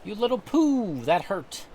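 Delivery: loud, high outraged yelp; angry old man. Acted. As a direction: snap at the kid who stepped on you.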